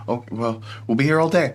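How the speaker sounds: cheerful parting call, going up; lightly voiced merchant. Merchant calling after the departing party, light and cheerful. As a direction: shrug it off and call after them.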